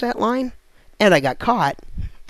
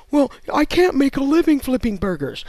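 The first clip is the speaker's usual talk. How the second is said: mock whiny complaint, raised pitch; whiny worker; 5 semitones higher, 3 dB louder than usual. Ranting comic mocks a whiny burger flipper. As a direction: sneer at the lazy burger flipper.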